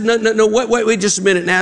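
high-pitched, flustered mock plea; mock-desperate stalling. Counselor stalling the jumper, mock-desperate. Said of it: "he's really desperate".

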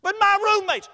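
high, loud fretting; mock panic. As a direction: burst out high and alarmed, ready to be cut off. Mock fretting, then hushed.

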